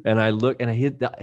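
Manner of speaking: normal talking voice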